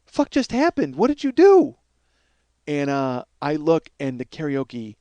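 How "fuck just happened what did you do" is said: high-pitched, stunned disbelief; aghast. Retold or imitated. Stunned bar crowd staring at the wreckage, aghast. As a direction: imitate a shocked onlooker, voice rising and louder at the end. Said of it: "they got their mouths open like".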